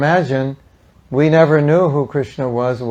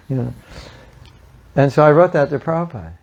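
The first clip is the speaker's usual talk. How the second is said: usual speaking voice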